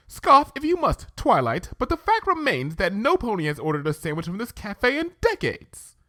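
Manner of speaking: Rarity, haughty but ladylike. Acted. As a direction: speak as a refined mare. Rarity's voice, posh character.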